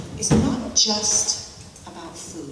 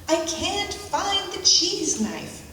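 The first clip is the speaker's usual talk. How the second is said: calling from the kitchen; 9 semitones higher, slower than usual. Retold or imitated. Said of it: "my Swedish stepson hollers from the kitchen".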